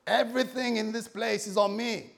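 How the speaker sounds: loud, high showy club boast; big spender. Acted. Lost son treating the boys in the club, loud showy voice. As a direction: call it out to the whole room. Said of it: "it's like, boys".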